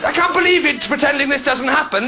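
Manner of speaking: usual speaking voice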